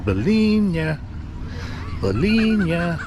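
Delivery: imitating a high, sing-song hawker's cry; beach vendor call. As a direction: call it out like a seller walking the beach. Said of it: "This guy's gone up and down the beach all day going".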